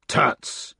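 a hoarse, breathless tut; hoarse exclamation. Acted. Gawain scoffing from the doorway, hoarse tut. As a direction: spit out a rough tut. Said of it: "exclaimed Gawain's hoarse voice".

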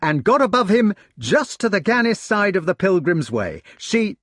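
high, fast, breathless enthusiasm; a young man who can't stop talking about his hawk; eager retelling. Acted. Gareth telling his brothers of the flight, eager and high.

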